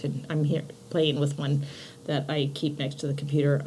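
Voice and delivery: quick whispered aside. Real, not acted. Playing with crystal, unvoiced.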